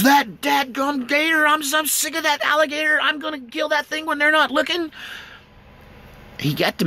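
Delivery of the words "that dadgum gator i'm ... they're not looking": high fed-up country grumble; the brother swears revenge on the gator; angry brother. Retold or imitated. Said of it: "He goes".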